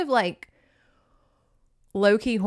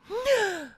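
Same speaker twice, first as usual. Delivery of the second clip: mock gasp; 13 semitones higher than usual. Maiden shocked by villain.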